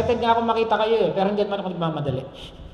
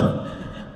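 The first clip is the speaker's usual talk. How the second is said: soft laugh; 3 dB quieter than usual. Real, not acted. Laughs at own joke.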